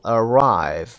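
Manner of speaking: arrive with RIVE drawn out and falling; stress on second syllable. Shown as a demonstration. Teacher giving an example to learners, second-syllable stress on arrive. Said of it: "stressed on the second syllable".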